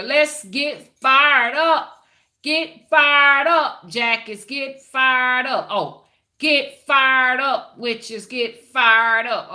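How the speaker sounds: loud, high, drawn-out hype cry; rallying shout. Real, not acted. Streamer rallying viewers to get fired up, loud rallying shout. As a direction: stretch it out loud to fire up the crowd.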